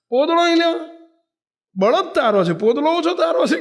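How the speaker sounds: high, loud mocking old woman; rude taunt. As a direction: sneer at him like a sharp old village woman. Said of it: "ટૂંકારો કરીને". (તોછડાઈથી ટોણો મારતી ડોશી)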